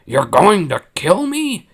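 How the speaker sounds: a startled admiral realizing she means it; shocked question. Acted. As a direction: Akbar stunned by Mon's threat, high and alarmed. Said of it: "he asks".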